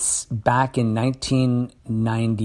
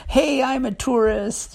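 much higher, slow and cartoonish; high mock announcement; 13 semitones higher, slower than usual. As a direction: squeak out the line like a clueless tourist. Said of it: "like, just screaming, like".

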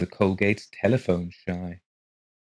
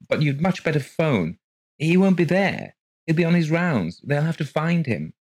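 higher, louder, firm insistence; insistent woman voice; 9 semitones higher, 4 dB louder than usual. Acted. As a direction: press her firmly to phone. Rose insisting.

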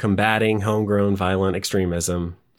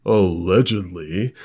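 news anchor voice. Pretend. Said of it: "my news anchor voice on".

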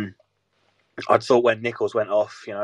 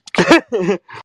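laughing; 11 semitones higher, 12 dB louder than usual. Real, not acted. Laughs off choking fit.

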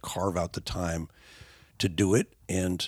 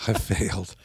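1 mock despair, breathy. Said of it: "my mind just twisting into a pretzel like".

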